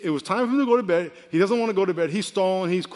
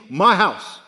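mock child louder with my stressed; child's claim; 7 dB louder than usual. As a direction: say it like a stubborn toddler. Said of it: "he says to me".

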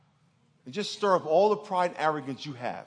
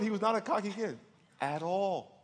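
usual speaking voice

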